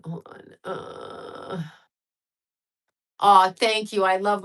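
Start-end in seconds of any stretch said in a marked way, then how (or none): 0.0-1.6 hushed aside, breathy and barely voiced; real off-mic whisper to a friend; quick whisper. Real, not acted. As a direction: whisper it off to the side so the audience can't hear.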